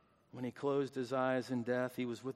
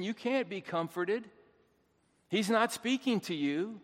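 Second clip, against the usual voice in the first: higher-pitched pulpit warning by its end; impassioned preaching; 3 semitones higher, 4 dB louder than usual. Real, not acted. Preacher warning those who don't believe, impassioned preaching. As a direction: preach it in a rising, insistent build.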